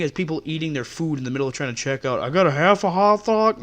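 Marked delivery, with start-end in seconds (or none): 2.2-3.6 lightly mock a chewing customer as Hank Hill. Cashier mocks chewing customer, light Hank Hill voice. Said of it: "did I sound like fucking Hank Hill".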